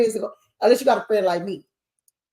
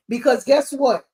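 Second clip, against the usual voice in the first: ordinary talk, nothing marked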